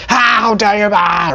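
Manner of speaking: yelling partner refuses to calm, hammed-up shout; mock outraged shout. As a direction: sputter with put-on fury. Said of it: "yelling partner retorts".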